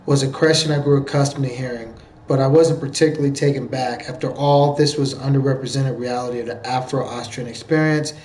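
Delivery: even, subdued article reading; reading aloud. Real, not acted. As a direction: read it off the page in a low-key, steady voice.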